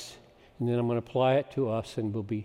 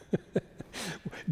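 laugh; 4 semitones higher, 8 dB quieter than usual. Real, not acted. Joyful outburst.

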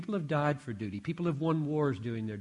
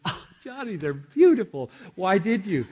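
higher-pitched, gushing imitation of his wife; delighted wife; 7 semitones higher, 7 dB louder than usual. Wife answering the door to anniversary daisies, delighted. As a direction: act out a wife's pleased surprise, pitch jumping up. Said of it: "And she says".

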